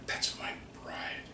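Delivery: hushed whisper, slow and soft; whispered tender aside. Pretend. As the late husband telling God about his wife, whispered. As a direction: breathe it out like a proud secret.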